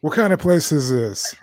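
a pampered bulldog in a shabby place. Pretend. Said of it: "That's a bulldog voice for you".

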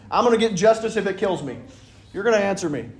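defiant widow. Acted. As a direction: insist like you won't leave. Persistent widow voiced defiantly.

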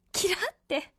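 lightly imitated anime cuteness; Ranka Lee. Fan savoring the guest's line, cute imitation. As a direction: playfully echo a cute idol catchphrase. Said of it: "可愛いよね".

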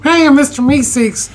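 high, eager cartoon voice; chirpy helper calling for attention; Mister Meeseeks voice. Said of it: "Look at me".

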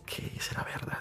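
a hushed whisper to himself; whispered doubt. Retold or imitated. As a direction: susurra la duda como pensando en voz baja. Retold inner doubt. (susurro incrédulo)

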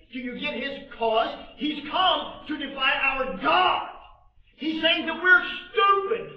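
building from a sharp question to a high shout; rising indignant rebuke. Acted. David to older brother Eliab, rising to an indignant shout.

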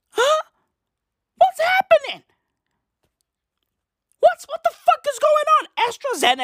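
high squeaky what is happening; cartoonish high-pitched disbelief; mock falsetto outrage. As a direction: shriek it in a silly high voice. Exasperated high put-on voice.

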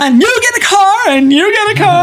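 loud, high-pitched giveaway announcement; Oprah Winfrey. Acted. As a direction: shout it out like a talk-show host handing out prizes. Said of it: "Oprah Winfrey".

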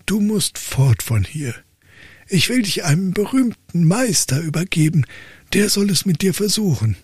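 high, exasperated father voice; old count lecturing his dull son; stern old count. Acted. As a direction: play the despairing old father.

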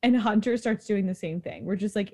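usual speaking voice

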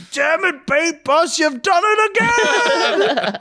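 high, loud, slow shout; hammed-up cartoon villain curse. Guy throwing oranges at the van, hammy high shouted curse. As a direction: shake your fist at the van. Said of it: "being like".

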